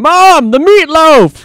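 high, loud whiny yell to mom; yelling across the room. Retold or imitated. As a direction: bellow it upstairs like a spoiled grown son. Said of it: "Reminds me of, uh, Wedding Crashers".

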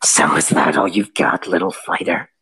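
low, taunting beast voice; taunting monster. Acted. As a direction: sneer it like a mocking wolf. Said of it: "and this time just goes".

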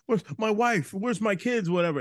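high, hammed-up frantic questions; mock frantic George. Imitating George asking the cop about his family, frantic. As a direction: demand answers in a panic. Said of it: "he's like".

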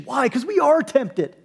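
ordinary talk

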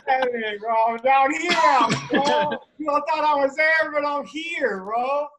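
high, loud teasing call; playful calling out. Real, not acted. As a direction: shout it out to friends, joking. Calling out from new square.

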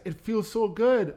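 drawn-out mock quote of a lover; retold girlfriend. Retelling partner's tearful words.

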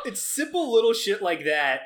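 usual speaking voice

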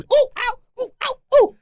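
high yelping ooh and ow; hammed-up high-pitched yelps; mock squealing. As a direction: yelp like stepping on tacks. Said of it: "like a little fourteen-year-old girl".

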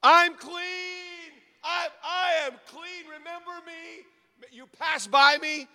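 high, loud cry of a healed man; loud joyful proclaiming. Acted. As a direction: tell the passersby you're healed. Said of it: "He's saying it in a loud voice".

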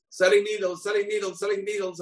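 high, sing-song cry of "Selling needles"; a peddler calling out his wares outside the jail; street hawker call. Acted. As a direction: hawk needles to the jail window. Rabbi poses as a needle seller.

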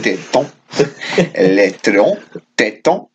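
French accent. Shown as a demonstration. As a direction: say "Les Trios Tétons" carefully in French. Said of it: "let's go into the French".

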